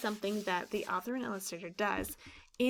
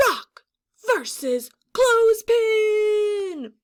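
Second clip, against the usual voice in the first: much higher, louder, drawn-out fight call; battle announcement; 11 semitones higher, 12 dB louder, much slower than usual. Acted. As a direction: announce the bout like a ring announcer.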